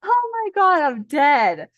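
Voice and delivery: high, loud burst of disbelief; real shock at the card. Real, not acted. Shocked by the card she drew.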